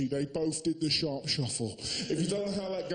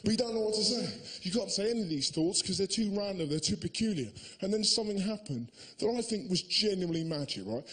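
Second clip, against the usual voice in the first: normal talking voice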